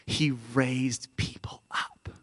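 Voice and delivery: slow, near-whispered preaching; hushed reverence. Real, not acted. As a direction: let it sink to a slow whisper.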